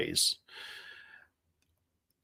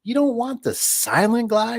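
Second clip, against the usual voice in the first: much higher, put-on voice, slow on the question; jokester vendor; 13 semitones higher, slower than usual. Jokester vendor teasing Dave about rollers, high put-on voice. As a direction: play the joking vendor pretending to be shocked. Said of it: "He's like".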